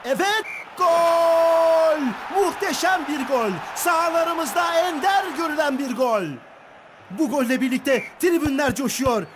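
drawn-out euphoric goal shout, high; screaming football commentator. Acted. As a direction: stretch the goal call, roaring with joy. Goal scored in match commentary.

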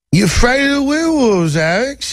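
high, playful mock Cockney; Oliver Twist Cockney. Interviewer teasing Alex about werewolves, high mock Cockney. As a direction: swoop up teasingly in put-on Cockney. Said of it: "He didn't really speak with that Oliver Twist Cockney accent".

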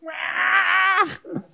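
high wailing scream, mimicking a child; imitated child's scream. Preacher imitating children when mum's gone, screaming wail. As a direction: wail like a little kid without mum. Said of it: "What are little children like?".

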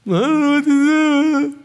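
high, slow, loud whimper; whiny crying man. Retold or imitated. Preacher mocks men who won't figure it out, loud whine. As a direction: stretch it out like a helpless whiner. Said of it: "all crying and upset going".

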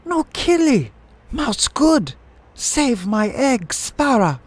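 very high, loud squawking plea; frantic mother bird at the top of the voice; mother sparrow crying out. A narrator's reading. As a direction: screech desperately to protect someone. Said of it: "The mother sparrow was crying out".

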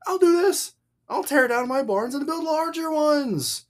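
high-pitched mock-resolute fool; emphatic mockery. Voicing the foolish rich man to the crowd, mockingly emphatic. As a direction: make an example of him with exaggerated emphasis. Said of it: "You just can't hear the emphasis".

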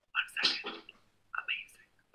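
awed breathless whisper. Acted. Streamer marvelling at a blender's power in an awed whisper. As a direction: hush it like it's sacred. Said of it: "awed whisper about a blender".

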